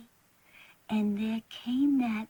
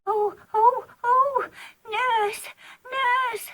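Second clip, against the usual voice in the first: high, crying whimpers for nurse; frightened crying; 10 semitones higher, 3 dB louder, slower than usual. Acted. Frightened child crying out for her nurse. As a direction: wail for help in fright.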